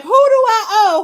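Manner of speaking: mock laughing denial; laughing disbelief. [name] laughing off the debt accusation.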